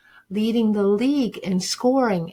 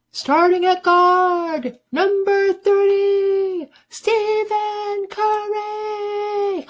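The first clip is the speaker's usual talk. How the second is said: high, slow, drawn-out announcer call building to a shout; arena announcer; 11 semitones higher, much slower than usual. Pretend. Dreaming boy hears the announcer call his name, slow and high. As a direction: boom it out like an arena announcer introducing a star.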